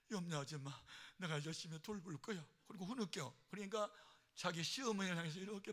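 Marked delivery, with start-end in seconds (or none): none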